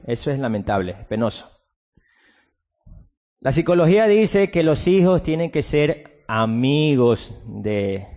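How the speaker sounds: ordinary talk, nothing marked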